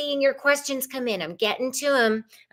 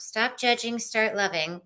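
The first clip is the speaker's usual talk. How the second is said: usual speaking voice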